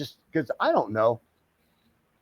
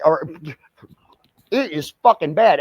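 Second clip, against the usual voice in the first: ordinary talk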